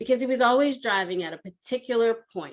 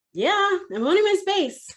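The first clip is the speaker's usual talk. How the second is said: higher, emphatic retort; exasperated reply; 9 semitones higher, 4 dB louder than usual. Retold or imitated. Employee answers her manager's repeated question, exasperated. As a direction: answer back with pitch jumping up.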